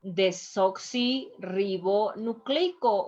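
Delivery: "Desoxirribonucleico" slowly, with only brief breaks; measured teaching reading of "Desoxirribonucleico"; slow but joined up. Shown as a demonstration. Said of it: "we have to pronounce all the letters".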